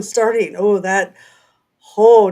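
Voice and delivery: ordinary talk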